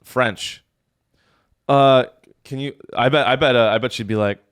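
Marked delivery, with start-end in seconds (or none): none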